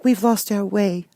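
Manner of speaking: put-on high, slow worried girl; young girl voice. A narrator's reading. Girl telling the mouse queen they're lost, high voice.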